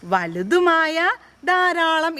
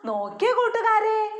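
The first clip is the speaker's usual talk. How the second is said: ordinary delivery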